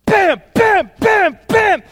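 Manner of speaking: loud, high shouted bams; stomping noises. Acted. Dad smashing the step with his foot, shouted impact noises. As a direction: punch out each bam like a heavy stomp.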